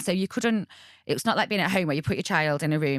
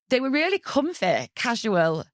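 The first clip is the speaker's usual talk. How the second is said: normal talking voice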